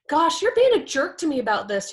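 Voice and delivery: acted offended comeback; defensive retort. Taking a partner's rant personally, defensive and indignant. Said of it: "we're not like".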